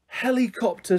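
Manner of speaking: high-pitched excited exclamation. A narrator's reading. Ruby finally realising what it was, excited. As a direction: say it with a child's excitement. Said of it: "said Ruby excitedly".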